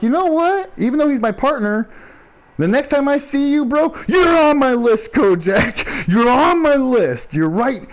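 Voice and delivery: high, slow playful menace; mock threat. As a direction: tease a rival threateningly. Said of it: "I'm like".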